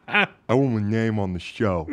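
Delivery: lightly slurred "I want my name"; drunk voice. Retold or imitated. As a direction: imitate a drunk friend making demands. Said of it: "In his drunk voice".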